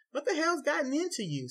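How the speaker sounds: very high, loud startled yell; wife yells after the wall slam. A narrator's reading. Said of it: "Susan yells at him".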